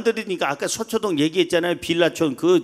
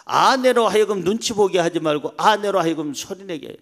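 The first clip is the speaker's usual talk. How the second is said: ordinary talk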